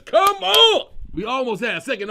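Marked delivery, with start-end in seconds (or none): 0.0-0.9 high, loud delighted yell; excited outburst. Real, not acted. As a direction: whoop it out in delight. Cracking up at the scene.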